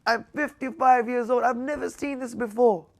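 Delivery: high, loud, strained with emotion; emotional outburst from a man overcome; retold tearful words. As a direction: imitate a grown man close to tears. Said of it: "He was like this".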